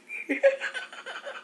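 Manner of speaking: silent wheezing laugh, very high; high breathless laugh. Real, not acted. Collapsing into silent laughter at the punchline. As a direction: laugh with no voice, air only.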